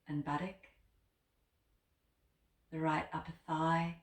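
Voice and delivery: soft, unhurried body-scan voice with long pauses; name each body part gently, leaving space after it; guided meditation. Acted. Yoga teacher guiding listeners through a body scan, guided meditation.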